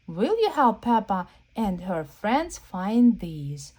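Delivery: energetic picture-book read-aloud; reading to children. Acted. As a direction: read the page out to the kids with gusto. Reads the book's printed text.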